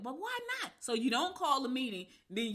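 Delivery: high, squeaky disbelieving why; retold outrage at the refusal; mock disbelief. Retold quarrel, incredulous question.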